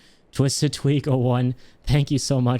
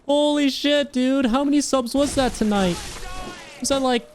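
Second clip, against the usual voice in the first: excited squealing. Real, not acted. Gift subs pour in, streamer freaks out.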